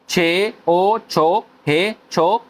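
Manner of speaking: very slow, syllable by syllable; sounding out slowly. Shown as a demonstration. Teacher spells the syllable out slowly for the learners. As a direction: build the syllable up one sound at a time. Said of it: "इसको हम read करेंगे छो था".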